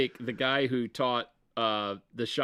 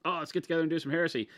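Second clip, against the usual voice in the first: fast eager quote; 6 semitones higher, much faster than usual. Retold or imitated. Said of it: "he's like".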